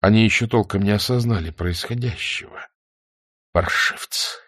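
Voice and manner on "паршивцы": low, soft, spent indignation; quiet reproach. A narrator's reading. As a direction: scold them under your breath. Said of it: "тихо говорил Владимиров". (тихо, с укором)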